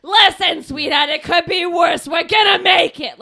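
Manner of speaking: blunt Brooklyn broad rallying someone; Brooklyn accent. Acted. Straight talker reassuring a client, loud Brooklyn accent. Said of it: "smoking Brooklyn accent to go".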